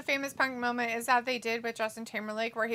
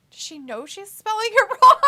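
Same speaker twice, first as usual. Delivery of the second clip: high, loud question breaking into laughter; laughing disbelief; 10 semitones higher, 11 dB louder than usual. Retold or imitated. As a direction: laugh through the quoted question. Laughs through her own quoted question.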